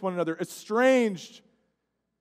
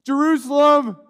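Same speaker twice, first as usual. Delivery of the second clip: anguished cry; 6 semitones higher, 7 dB louder than usual. Acted. Jesus' lament cried out.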